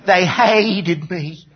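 high, drawn-out Southern complaint; mock-wounded Southerner, very slow; Southern accent. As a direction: stretch the grievance in a Southern drawl. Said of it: "all of a sudden turned Southern".

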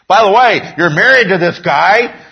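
loud, high, pointed reminder; acted indignant rebuke; emphatic reminder. Acting Joseph rebuking the wife.

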